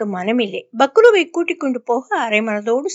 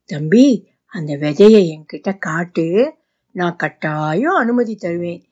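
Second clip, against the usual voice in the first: put-on lower, sly coaxing of a child; coaxing, scheming; 6 semitones lower than usual. A narrator's reading. Boss luring the boy to hand over seeds, lower sly coaxing. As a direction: sweet-talk the boy while plotting. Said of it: "அருணை வசப்படுத்த முயன்றார்".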